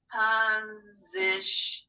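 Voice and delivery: slow, split into syllables; syllables separated. Shown as a demonstration. Teacher splitting the word into syllables, slow. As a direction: break it into beats slowly. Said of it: "separate the syllables".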